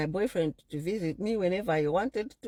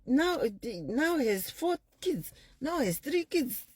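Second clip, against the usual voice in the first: nagging relatives; 8 semitones higher than usual. Retold or imitated. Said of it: "I was always reminded".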